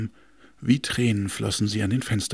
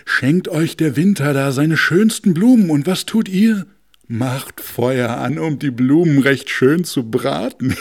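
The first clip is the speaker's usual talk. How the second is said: loud, scornful lecture; mocking north wind; 4 semitones higher, 6 dB louder than usual. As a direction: scold the foolish stove loudly. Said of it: "sagte er zu dem Ofen".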